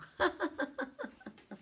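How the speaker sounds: high fond laugh at the dog; high laugh at her bored dog. Real, not acted. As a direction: laugh at the dog's antics.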